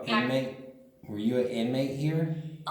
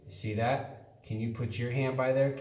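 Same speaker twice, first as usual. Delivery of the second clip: ordinary talk, nothing marked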